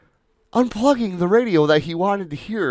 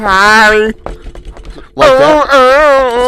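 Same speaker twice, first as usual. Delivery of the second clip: muffled voice; 9 semitones higher, 11 dB louder, much slower than usual. Pretend.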